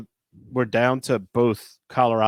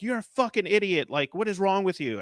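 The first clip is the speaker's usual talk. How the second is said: mock screaming coach; 9 semitones higher than usual. Coach berating the referee, mock high-pitched yell. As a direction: play an angry coach yelling, but only in pitch. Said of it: "screaming at the referee".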